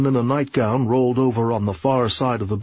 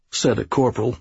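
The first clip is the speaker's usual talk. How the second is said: usual speaking voice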